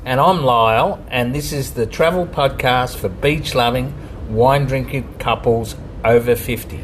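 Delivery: loud show-opener delivery; podcast host intro. Acted. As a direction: announce it like radio.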